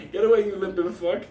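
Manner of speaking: high loud jeer; mock taunt. To the limping woman, high mocking jeer. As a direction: shout her off, mocking.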